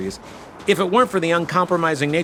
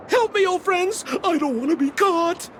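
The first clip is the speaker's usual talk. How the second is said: panicked plea; 9 semitones higher than usual. Acted. Fleeing the guards, calls for help.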